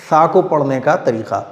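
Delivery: ordinary talk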